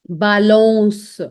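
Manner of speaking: "Balance" said ba-LONS, stretched, ending in S; French pronunciation demo. Shown as a demonstration. Teacher models CE ending, slow ba-lons. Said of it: "it gives a sound of S".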